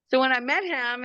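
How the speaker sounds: usual speaking voice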